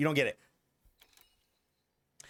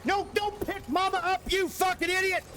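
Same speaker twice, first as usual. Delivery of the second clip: high squeaky mock yell; 11 semitones higher than usual. Yells at game creature in put-on voice.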